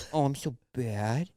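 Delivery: soft, slow, creepy moan; a hushed, drawn-out guilty moan; annoying voice. Shown as a demonstration. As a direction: drag it out, quiet and uncomfortable. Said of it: "just a really annoying".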